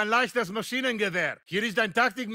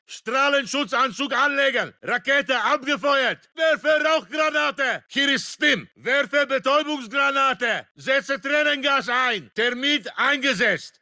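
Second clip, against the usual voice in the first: raised, forceful soldier's call; combat callout; 2 semitones higher, 4 dB louder than usual. Acted. Soldier warning squad of weapons being used, shouted. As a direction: call it out over gunfire. Soldier calling out gear deployed.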